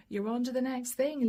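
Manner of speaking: said through a light laugh. Real, not acted. Reader amused by Libra rushing ahead, laughing. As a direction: let the laugh spill into it.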